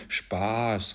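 plain reading voice

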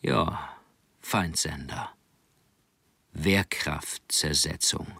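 low, slow hushed whisper; calm quiet tone. A narrator's reading. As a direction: say it calmly under the breath. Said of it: "sagte in ruhigem Ton".

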